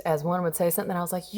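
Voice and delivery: ordinary delivery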